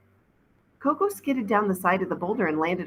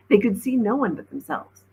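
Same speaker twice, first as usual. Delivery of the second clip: usual speaking voice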